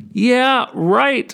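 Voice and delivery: high, scoffing dismissal; sarcastic disbelief. Real, not acted. Storyteller scoffs at the fable's happy moral, sarcastic disbelief. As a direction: scoff it out, breaking into a laugh.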